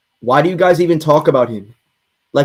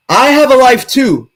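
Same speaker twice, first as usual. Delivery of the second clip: defensive shout; 7 semitones higher, 6 dB louder than usual. Real, not acted. Defensive outburst to chat.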